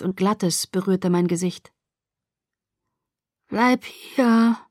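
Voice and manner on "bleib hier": drowsy, slurred plea, pitched higher. A narrator's reading. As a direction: slur it as sedation takes hold. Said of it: "lallte ich".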